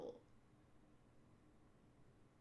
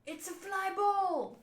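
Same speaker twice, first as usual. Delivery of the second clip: high, drawn-out cry; alarmed exclamation; 7 semitones higher, slower than usual. Acted. Fighter spots the ball overhead, acted high alarmed cry. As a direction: stretch it out in a high acted wail.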